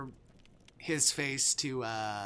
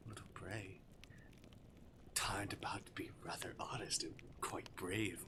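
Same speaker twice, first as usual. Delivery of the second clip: softer, lower musing with a touch of amusement; amused, faintly incredulous; 3 semitones lower, 9 dB quieter than usual. Acted. Demon appraising his brave little prey, understated amusement. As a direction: marvel quietly, as if mildly entertained. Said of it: "amused and faintly incredulous".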